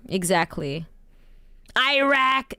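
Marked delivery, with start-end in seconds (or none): 1.8-2.5 Iraq said as "eye-RACK"; mimicked mispronunciation. Streamer mocking how Westerners say a country, mimicked "eye-rack" Iraq. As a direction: open Iraq with a long "eye" sound. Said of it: "the way that they say".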